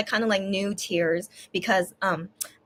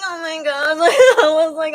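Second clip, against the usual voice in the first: higher, half laugh half sob; laughing through tears; 6 semitones higher, 6 dB louder than usual. Real, not acted. Retelling reading the message, laughing while crying. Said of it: "making me laugh while I'm still crying".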